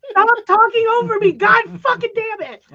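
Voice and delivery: loud, high, fed-up outburst; domineering. Real, not acted. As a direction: shout them down to take the floor. Cut off repeatedly, snaps back.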